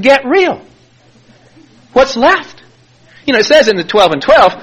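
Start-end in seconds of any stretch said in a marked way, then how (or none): 0.0-2.6 high-pitched mock protest; hammed-up disbelief; indignant protest. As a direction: push back in a high, incredulous voice. Retold protest to his sponsor.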